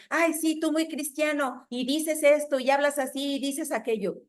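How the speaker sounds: a scornful "ay, sí" mimicking critics; mocking critic.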